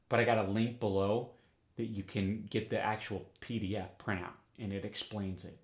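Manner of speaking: ordinary delivery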